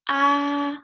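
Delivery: "ah" held high, flat and loud; flat first tone. Shown as a demonstration. Teacher explains first tone, "ah" high and flat. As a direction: sustain "ah" on one high level note. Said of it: "first tone is a flat tone".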